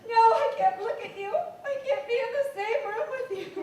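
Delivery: words breaking into tearful laughter; hysterical laugh-crying. Acted. Wife laughing and crying as she refuses her husband. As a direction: laugh through your tears. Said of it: "you're hysterical".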